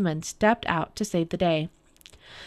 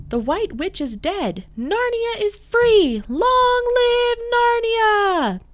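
high cheer swelling to long, loud shouts; triumphant cries; 5 dB louder, slower than usual. A narrator's reading. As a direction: build to a high, drawn-out victory cry. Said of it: "The cries went up".

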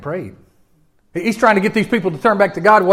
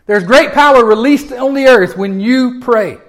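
louder, higher, slow proclamation; full-force preaching; 6 semitones higher, 6 dB louder, slower than usual. Acted. Preacher declaring the power of prayer, loud and slow. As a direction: thunder it out to the congregation.

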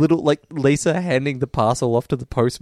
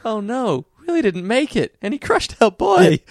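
higher mock lament; character quote; 8 semitones higher than usual. Retelling the line, high mock lament. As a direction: wail it in a high voice. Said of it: "he has the great line".

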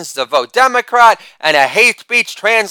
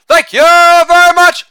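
much higher, slow, loud showman's thank-you; put-on catchphrase voice; 12 semitones higher, 6 dB louder, slower than usual. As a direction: stretch it out like a lounge singer milking applause. Repeated catchphrase after joke.

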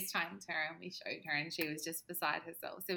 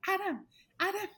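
urgent excited wake-up; 9 semitones higher, faster than usual. Retold or imitated. Waking her husband in the night, urgent and excited. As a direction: shake him awake with urgency, fast and high.

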